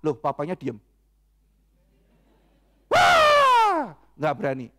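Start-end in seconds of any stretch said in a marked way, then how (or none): 2.9-3.9 put-on loud high wail of a scolded child; acted scream. Scolded child screams and silences papa, long acted shriek. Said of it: "terus dia teriak".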